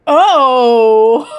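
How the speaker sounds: loud, surprised laugh; real laughter bursting out at the joke. Real, not acted. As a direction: crack up out loud.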